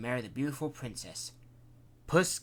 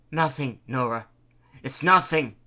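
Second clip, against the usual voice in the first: louder breathless stammer; out of breath, stammering; 9 dB louder than usual. A narrator's reading. Husband stammering breathlessly to his wife. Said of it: "Out of breath, stammering".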